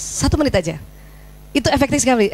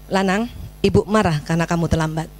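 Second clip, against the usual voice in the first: low, controlled scolding; measured reprimand; 7 semitones lower than usual. Shown as a demonstration. Mother telling son why she is upset, measured reprimand. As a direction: state the displeasure without blaming. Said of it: "tegur perilakunya".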